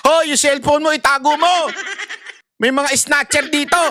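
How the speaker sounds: high, urgent call to a jeep passenger; driver warning. Retold or imitated.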